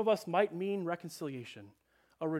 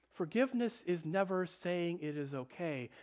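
ordinary talk

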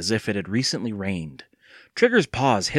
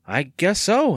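higher-pitched exclamation; exclaiming; 8 semitones higher, slower than usual. A narrator's reading. Andy agreeing with Evan about the growing gunk, exclaiming. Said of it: "Andy exclaimed".

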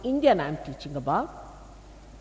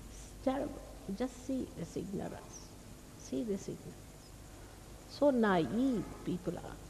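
dismayed hush; 8 dB quieter than usual. Real, not acted. Dismayed at a hostile questioner.

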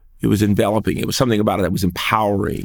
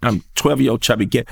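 usual speaking voice

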